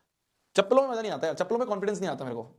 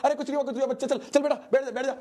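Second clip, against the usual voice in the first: much higher, hurried hushing grandpa; flustered grandfather; 10 semitones higher, faster than usual. Retold or imitated. Grandfather hushing the screaming boy in front of shops, flustered. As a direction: hush the scene quickly, embarrassed. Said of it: "तो उन्होंने कहा".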